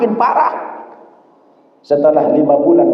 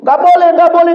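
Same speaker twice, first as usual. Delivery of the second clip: high, louder refusal from the doctor; doctor refusing; 4 dB louder than usual. Retold or imitated. Doctor refuses to let the mother go home, loud and high. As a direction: shut the request down firmly. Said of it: "kata dokter".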